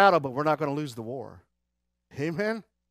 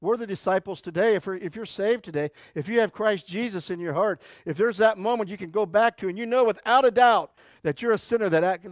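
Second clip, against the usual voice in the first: ordinary talk, nothing marked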